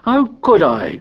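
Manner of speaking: high-pitched, aggrieved protest; indignant, pitch thrown up; indignant cry. A narrator's reading. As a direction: protest like a wronged boy. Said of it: "cried Piggy indignantly".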